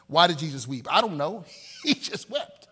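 ordinary delivery

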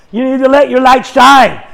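ordinary delivery

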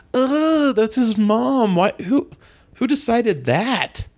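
high, slow, disgusted whine; grossed out. Retold or imitated. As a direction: recoil in disgust. Grossed out by the mom scene.

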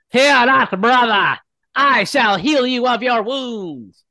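high, loud declamatory healer voice; zealous acolyte. Acted. Acolyte healing a wounded brother, high fervent proclamation. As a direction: proclaim it loudly, drawing out the final phrase.

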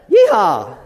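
响亮的牛仔欢呼; 拉长声音喊出 Yeehaw. Shown as a demonstration. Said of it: "I should have thought of that".

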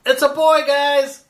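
high, loud joking announcement; excited announcement. Acted. Player joking about the dragon's sex, high and excited. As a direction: announce it like a proud parent.

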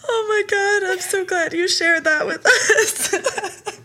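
high, drawn-out words through real laughter; helplessly tickled, laughing while talking; crying with laughter. Real, not acted. Host thanking guest for sharing the clip, crying laughing. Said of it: "I'm crying for".

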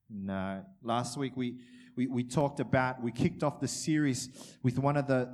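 normal talking voice

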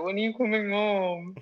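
high, drawn-out mock plea; imitated mother missing son. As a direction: stretch it out like a pining mother. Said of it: "Three weeks later".

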